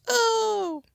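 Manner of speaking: very high startled oh; shrill oh; surprised squeal. Real, not acted. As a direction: yelp in surprise. Sudden surprise.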